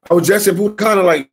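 high, fast excited reply. Real, not acted. Host answering chat about Punta Cana, high and quick.

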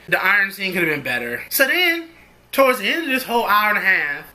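ordinary delivery

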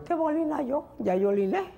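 high-pitched put-on woman's voice; protesting Chinese wife. Shopkeeper's wife refuses her husband's second request, high protesting voice. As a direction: squeal the objection in a high mock-Chinese voice. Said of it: "Dice la mujer".

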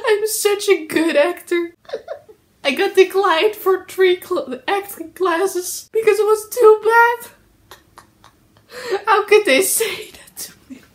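high, mock-tearful whine; badly faked sobbing self-pity; hammed-up fake crying. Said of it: "I'm gonna fake cry this whole video".

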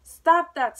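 very loud, high queen's shout; screamed command. A narrator's reading. Queen halting the bowing gardeners, screamed. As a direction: yell it to halt them. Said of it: "screamed the queen".

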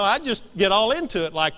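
ordinary talk, nothing marked

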